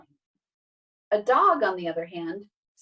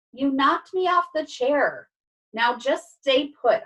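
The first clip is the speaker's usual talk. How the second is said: slow, louder, higher reproach; annoyed scolding; 6 semitones higher, 5 dB louder, slower than usual. Acted. Cat knocked off the chair to dog, annoyed. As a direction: scold slowly and firmly.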